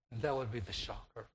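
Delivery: usual speaking voice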